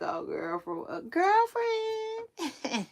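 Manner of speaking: put-on very high, slow, drawn-out "girlfriend"; Tisha Campbell impression. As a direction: stretch "girlfriend" way up like a sitcom diva. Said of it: "Like Tisha Campbell from Boomerang".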